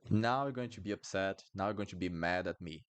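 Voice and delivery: ordinary talk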